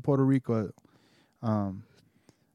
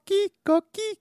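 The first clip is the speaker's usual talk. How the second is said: coqui frog call; faster than usual. Retold or imitated.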